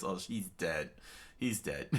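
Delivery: plain reading voice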